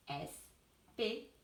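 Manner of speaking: Espérer drawn out slowly and softly; slow teacher split of Espérer; slow syllables. Shown as a demonstration. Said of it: "couper le mot".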